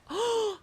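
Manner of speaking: sharp high excited gasp. Real, not acted. Player gasping at the smut reading girl. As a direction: gasp in delight.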